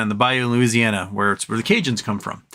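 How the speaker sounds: ordinary talk, nothing marked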